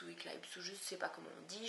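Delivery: breathy whisper, to herself; whispered aside. Real, not acted. Unsure of the name, she whispers an aside to herself. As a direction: whisper it as a quick aside to yourself.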